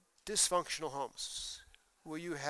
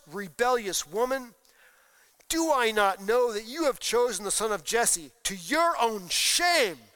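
loud, hammered-out curse at his son; angry king's rebuke; 4 semitones higher, 7 dB louder than usual. Acted. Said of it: "Then Saul's anger was kindled".

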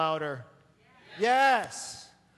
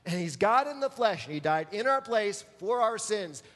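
usual speaking voice

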